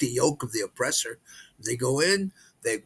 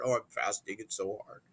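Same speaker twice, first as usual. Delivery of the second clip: whiny poor-me moan, a bit softer; mock complaint; 3 semitones lower, 5 dB quieter than usual. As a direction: sigh out the hardship of fasting like a martyr. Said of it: "I've sort of complained about it".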